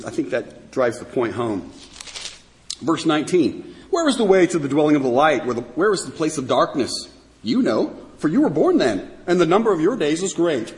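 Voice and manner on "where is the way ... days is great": emphatic sing-song taunting; sarcasm. Retold or imitated. God quizzes Job on light and darkness, heavily sarcastic. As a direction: pile on the mock deference with exaggerated emphasis. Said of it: "This is called sarcasm".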